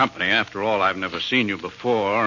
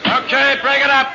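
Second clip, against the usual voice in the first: calling out; 14 semitones higher, 4 dB louder than usual. Acted. Policeman breaking up a crowd.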